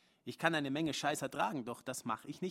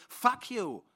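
high, loud spat curse; angry curse; 11 semitones higher, 8 dB louder than usual. Acted. Man curses at Joan while leaving, high and loud. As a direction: throw it at her. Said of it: "sage ich".

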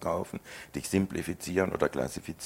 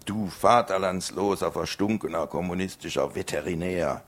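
louder, higher hurled curses; enraged farmer swearing; furious insults; 4 semitones higher, 5 dB louder than usual. Acted. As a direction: spit the insults at him. Farmer cursing him out.

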